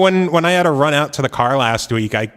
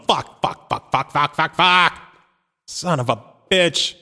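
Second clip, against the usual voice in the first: re-enacted cursing; 5 semitones higher, 3 dB louder than usual. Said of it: "It's just me going".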